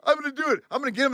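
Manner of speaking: high, insistent voice; stubborn resolve. Retold or imitated. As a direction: talk yourself into pressing on. Retold stubborn resolve despite alarm.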